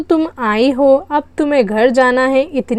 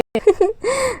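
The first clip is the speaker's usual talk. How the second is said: a very high, much softer giggle; a shy, breathy little laugh; 9 semitones higher, 5 dB quieter than usual. Acted.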